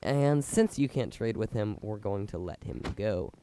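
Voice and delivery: ordinary delivery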